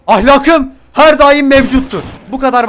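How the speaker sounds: slow, loud grandiose declaration; mock solemn vow. Streamer mock-proclaims his morals to viewers, pompous.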